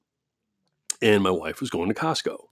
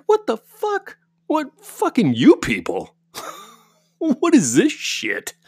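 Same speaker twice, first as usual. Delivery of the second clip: higher, slow, disbelieving mock outburst; inner outrage; slower than usual. Speaker reacting inwardly to the old man's remark, disbelieving mock outrage. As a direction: replay the stunned reaction, stretched out and incredulous. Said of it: "I was like".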